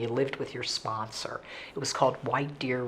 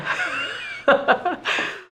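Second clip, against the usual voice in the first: high, loud peal of laughter; real laugh; 14 semitones higher, 6 dB louder than usual. Real, not acted. Speaker laughs at own discoing memory, loud high laugh.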